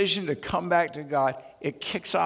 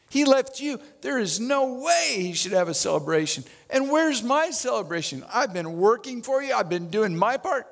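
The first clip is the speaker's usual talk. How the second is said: higher, aggrieved protest; indignant older brother; 6 semitones higher than usual. Acted. Older brother complaining to father about the party, indignant and higher. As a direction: whine out the unfairness of it all.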